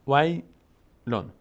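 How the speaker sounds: ویلون very slow, loud and high, split into two parts; teacher sounding out ویلون with a long pause between syllables. Shown as a demonstration. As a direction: slowly demonstrate ویلون in separate syllables. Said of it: "کلماتش رو و حرف به حرفش رو پیش بریم".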